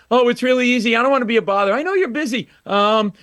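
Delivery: high, rushed starstruck babble; nervous babbling. Retold or imitated. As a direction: babble on, too eager to please. Nervous babbling to [name].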